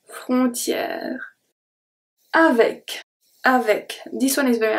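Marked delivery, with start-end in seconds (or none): none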